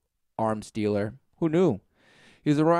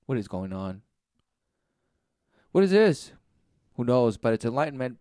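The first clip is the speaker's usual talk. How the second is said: ordinary talk, nothing marked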